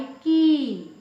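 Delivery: की stretched long at the end. Shown as a demonstration. Teacher giving a sentence for big matra, long vowel. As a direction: hold की out slowly. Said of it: "बड़ी की मात्रा का उच्चारण".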